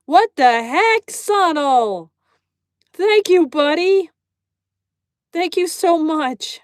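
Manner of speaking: loud, high-pitched grateful gushing; excited thanks. Real, not acted. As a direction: thank a friend who surprised you.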